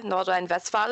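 Nordrhein-Westfalen said the native German way; German pronunciation. Shown as a demonstration. Gives the German name of the region.

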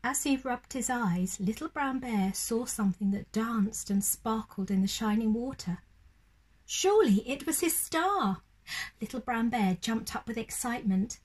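put-on gentle storybook narration for children; lively read-aloud voice for little ones; bedtime story reading. A narrator's reading. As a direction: read it like a bedtime picture book.

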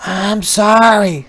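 high, slow little sorry; apologetic spider. A narrator's reading. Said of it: "said the spider".

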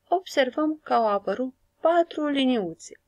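normal talking voice

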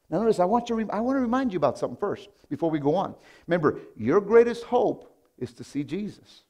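rising preacher's urgency; preaching. Acted. Pastor urging the congregation, preaching with rising force. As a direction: press the point home to the church.

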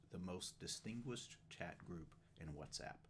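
usual speaking voice

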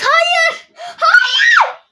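high, loud wailing no through laughter; laughing shriek. Real, not acted. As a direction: cry out in dismay, laughing. Horrified again at messages.

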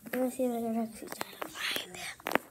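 hushed sneaky whisper. Real, not acted. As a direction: whisper while searching. Seeker sneaking around.

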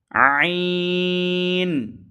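আইন drawn out long and level; three-count stretch. Shown as a demonstration. Teacher stretching আইন slowly. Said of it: "তিন আলিফ".

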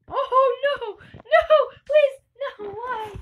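real panic as the murderer catches her; shrieking no and please in real panic; panicked scream. Real, not acted. Killed in game.

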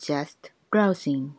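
very slow, each syllable clear; slow teaching pace; slow drill. Shown as a demonstration. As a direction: spell it out slowly for learners. Slow drill repeated twice.

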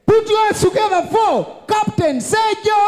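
slow, loud, sung-out intro; grand entrance announcement. Acted. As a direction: belt the name like a ring announcer. Host announces comedian entrance.

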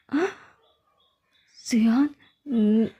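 slow, breathless whisper of her name; shocked whisper. Acted. Caught off guard, whispering her name. Startled at seeing her there.

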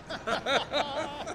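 short bright laugh that rises at the end. Real, not acted. Teasing a cigar maker about his suits, laughing. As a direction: laugh at your friend's new look.

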